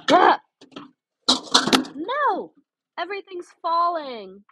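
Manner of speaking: sudden high yelp of alarm as things tumble; startled cry. Real, not acted. Streamer watching her box spill, startled high cry.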